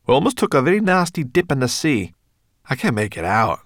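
high, loud, quick character voice; agitated story character. Acted. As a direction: complain with urgency. Story character speaking in dialogue.

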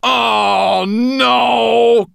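high, loud defiant shout; defiant cry. Acted. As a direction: cry it out in protest. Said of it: "retreated defiantly".

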